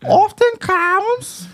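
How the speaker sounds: very high, slow mock Chinese accent; exaggerated Chinese accent caricature; rude Chinese accent. Shown as a demonstration. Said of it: "it was a rude Chinese accent".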